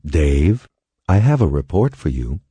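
lightly voiced computer announcement; computer announcing. A narrator's reading. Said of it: "when Hal announced".

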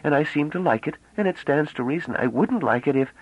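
put-on high girlish voice, running on without pause; rapid chatter. A narrator's reading. Bliss chattering to the men, high and breathless. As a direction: babble on eagerly without stopping for breath. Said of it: "She was speaking rapidly, almost breathlessly".

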